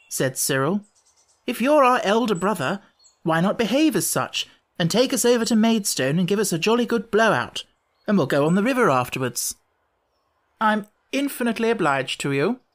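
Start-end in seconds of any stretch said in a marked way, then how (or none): 1.5-9.5 lightly voiced, higher-pitched boy's demand; challenging younger brother. 10.6-12.6 high, lofty politeness; courteous. A narrator's reading. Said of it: "said the lamb courteously".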